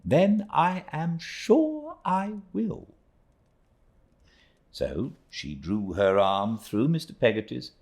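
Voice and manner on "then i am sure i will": high, slow, clipped aunt; short good nature. A narrator's reading. Aunt agreeing to stay, high and slow. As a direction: warm but short, like a brisk old lady. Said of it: "with short good nature".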